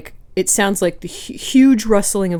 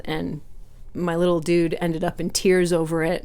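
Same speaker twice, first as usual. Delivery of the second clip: ordinary talk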